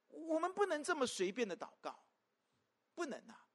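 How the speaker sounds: ordinary delivery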